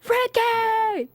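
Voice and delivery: high, loud holler of a name; reenacted high-pitched shout; random yell. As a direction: yell it out suddenly to break the tension. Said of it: "you just yelled it".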